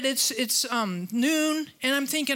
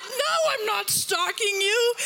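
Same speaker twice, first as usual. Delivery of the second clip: high, slower, raised protest; indignant denial; 9 semitones higher than usual. Retold or imitated. Preacher retelling her rattled reply to the driver, shouted denial. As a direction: push back hard, rattled and defensive. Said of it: "I'm like".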